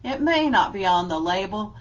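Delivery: lively read-aloud with a steady rhyme beat; reading to children. Acted. Teacher reads an alphabet book to her class, lively and rhythmic.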